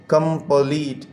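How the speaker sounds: Complete chopped into कं and pleat; wrongly broken reading. Shown as a demonstration. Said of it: "इस तरह नहीं है".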